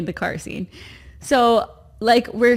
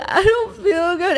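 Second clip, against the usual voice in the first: high, whiny tearful imitation; mock crying; 8 semitones higher than usual. Wife re-enacts crying while still giggling, mock-tearful. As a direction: wail it like laughter tipping into tears. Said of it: "as I'm just crying, laughing".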